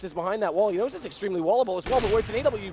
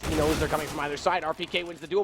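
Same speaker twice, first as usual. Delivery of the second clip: normal talking voice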